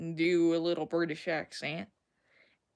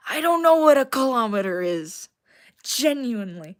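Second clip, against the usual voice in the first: raised, emphatic confession; loud admission; 2 semitones higher, 8 dB louder than usual. Real, not acted. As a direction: blurt it out loudly. Admits ignorance of the metric system.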